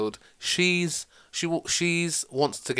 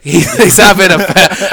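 laughing; 6 semitones higher, 14 dB louder, slower than usual. Real, not acted. Laughing while pitching silly sequel.

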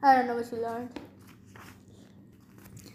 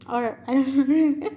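usual speaking voice

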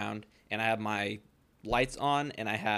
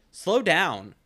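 raised, higher scolding call; a retold sharp warning, louder and higher; mock scolding shout; 7 semitones higher, 7 dB louder than usual. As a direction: bark it like a busybody scolding a driver. Neighbor scolding a passing driver.

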